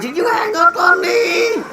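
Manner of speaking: high, slow shrill-pitched wife on the phone; mock furious wife at high pitch; angry wife. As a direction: mimic the wife yelling down the line. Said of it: "She goes".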